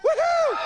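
a loud falsetto woohoo; high whoop. Acted. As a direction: whoop like a party animal. Whooping at crowd.

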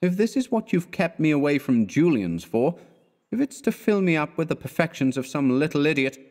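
exasperated friend berating; mild wrathful rant. Acted. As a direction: scold him with rising heat. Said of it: "he continued wrathfully".

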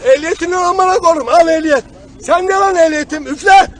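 loud, heated outburst; angry cursing. Real, not acted. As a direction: curse at an officer mid-quarrel. Cursing over licence check.

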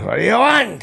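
high, loud explosive impression; Pacino. Angry actor snapping at a fan, loud mock yell. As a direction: mimic an actor's sudden hollered outburst. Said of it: "'cause, you know, Pacino".

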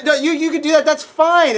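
normal talking voice

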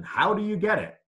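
ordinary talk

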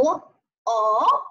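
long 哦 with a rising tone; second tone. Shown as a demonstration. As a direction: lift 哦 upward. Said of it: "二声呢读哦".

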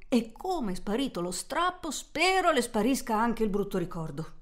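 usual speaking voice

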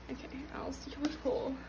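hushed whisper; whispered aside. Real, not acted. Fan whispering to herself before pulling the card. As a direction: whisper it to yourself.

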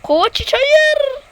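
high, slow wailing cry; anguished no. Real, not acted. Gamer crying out at his player, anguished. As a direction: despair at the lost chance.

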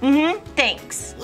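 ordinary talk

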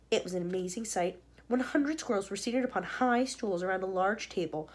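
plain reading voice

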